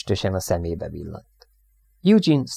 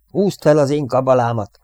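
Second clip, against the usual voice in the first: loud, raised instruction; urgent urging; 5 semitones higher, 5 dB louder than usual. A narrator's reading. Radioman offers his mascot to panicked crewmate, urging. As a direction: press it on someone insistently.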